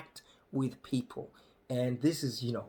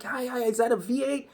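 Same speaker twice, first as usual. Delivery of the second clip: eager local; 11 semitones higher than usual. Retold or imitated. Locals quizzing about the truck.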